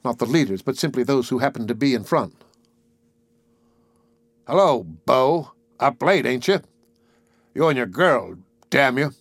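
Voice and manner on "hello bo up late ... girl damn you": lightly voiced drunk heckler; drunken soldier. A narrator's reading. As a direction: taunt them like a drunk soldier. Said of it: "two very drunken soldiers".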